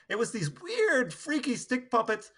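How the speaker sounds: normal talking voice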